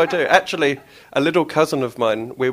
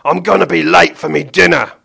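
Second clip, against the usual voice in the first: higher, loud grumpy villain; Mister Gum; 6 semitones higher, 11 dB louder than usual. Acted. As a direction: fret about your dinner. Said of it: "Mister Gum mutter".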